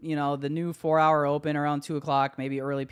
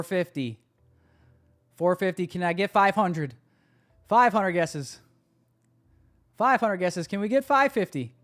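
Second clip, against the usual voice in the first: higher, louder auction call; auctioneer; 7 semitones higher, 4 dB louder than usual. Pretend. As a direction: play the auctioneer calling bids. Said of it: "I wish I could talk like an auctioneer".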